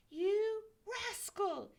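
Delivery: high, slow angry shout. A narrator's reading. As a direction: yell it after him, outraged. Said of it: "he shouted after Jack Frost".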